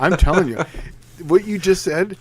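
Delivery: talking through real laughter; laughing. Real, not acted. Racer recognizing his own old hustle lines, laughing. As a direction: laugh as you speak, starting loud. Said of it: "I'm laughing because I've".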